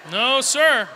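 mock disapproval. Preacher mimicking Christians who disapprove of Anchorman, mock indignant. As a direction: play an offended churchgoer putting their foot down.

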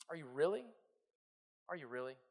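low, very soft insinuating question; subversive doubt. Acted. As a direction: sink almost to nothing, sowing doubt. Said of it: "He's subversive".

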